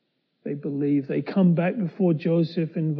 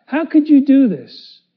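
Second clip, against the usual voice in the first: indignant accusation; 9 semitones higher, 10 dB louder than usual. Acted. Steward accuses brothers of theft.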